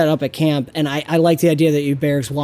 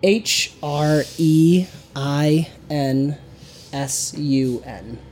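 slow letter-by-letter spelling, each letter separate; spelled out; slower than usual. Shown as a demonstration. GM spelling out the faction name slowly for a player.

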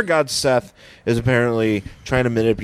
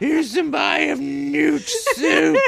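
high, slow laughing witch; 13 semitones higher, much slower than usual. Pretend. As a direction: cackle through it like a witch. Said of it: "I'm Seth Rogen the witch".

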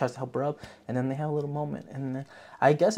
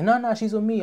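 mock flustered fighter; 11 semitones higher, 6 dB louder than usual. Fighter covering for her.

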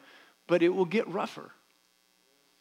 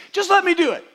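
mock stubborn teenager, much higher and louder; stubborn teen; 14 semitones higher, 10 dB louder than usual. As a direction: play the willful kid who wants his way.

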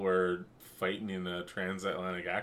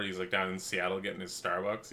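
usual speaking voice

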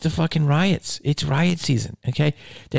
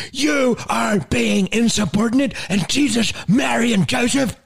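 high, outraged nun impression; stern Irish nun; 9 semitones higher than usual. Nun rebukes pupil who argues about the book, outraged mock Irish. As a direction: scold insubordination like a half-Irish nun. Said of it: "Like, just like that".

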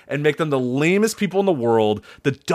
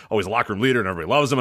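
rattled off; 5 semitones lower, much faster than usual. Retold or imitated. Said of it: "they tell you".

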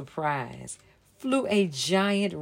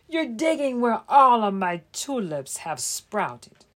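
a higher, louder indignant shout; 5 semitones higher, 7 dB louder than usual. Acted. Bunny shouting over his sprouted tulips. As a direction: shout at the trespasser. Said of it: "a brown bunny shouted".

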